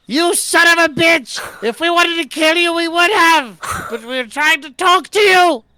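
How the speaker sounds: furious, high-pitched full yell; top-of-lungs shout. Acted. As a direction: bellow each phrase after a fleeing enemy. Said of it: "I shout out at the top of my lungs".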